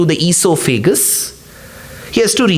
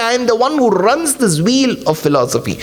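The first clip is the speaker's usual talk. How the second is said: ordinary talk, nothing marked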